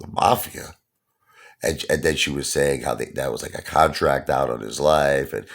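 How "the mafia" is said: higher, startled questioning echo; shocked father. Retold or imitated. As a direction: repeat it back in mock disbelief. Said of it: "had a cough. He dropped it".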